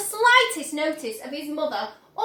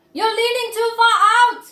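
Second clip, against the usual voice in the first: loud warning shout; 8 semitones higher, 9 dB louder than usual. A narrator's reading. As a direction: warn him loudly. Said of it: "shouted Mister Gloop".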